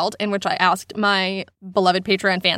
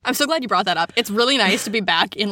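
usual speaking voice

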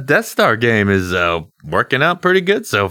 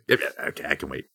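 muttered aside; 6 semitones lower, 10 dB quieter, faster than usual. Acted. Aside muttered under breath.

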